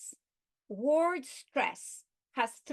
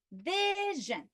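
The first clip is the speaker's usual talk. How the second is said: VI in "vision" raised and stretched; stress on penultimate syllable; 8 semitones higher than usual. Shown as a demonstration. Teacher illustrating penultimate stress, VI stressed in "vision". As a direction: hold the first syllable of "vision" up high. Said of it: "syllable before their last".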